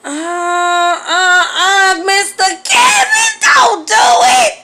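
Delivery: high, loud, slow hammed-up sob; mock wailing. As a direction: wail like it hurts, for laughs. Mock crying at his text.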